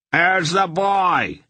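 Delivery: high, slow call from behind; ringing call. A narrator's reading. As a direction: call out sharply down the street. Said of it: "a voice rang out".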